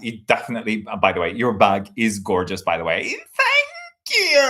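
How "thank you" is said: very high, stretched-out Australian "thank you"; Australian accent. Retold or imitated. Mimicking the guest's thanks in a high, drawn-out Australian accent. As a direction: draw out a mock Australian thanks. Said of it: "this is definitely Australian".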